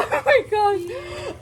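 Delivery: high, slow, laughing squeal; thrilled squeal. Real, not acted. To viewers while unboxing, squealing with delight. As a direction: gush it out, nearly in tears with joy. Opening her new fursuit.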